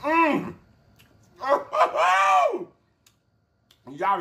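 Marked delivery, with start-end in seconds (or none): 1.4-2.6 high shouted burst of laughter; loud laugh. Real, not acted. Delighted reaction to the food.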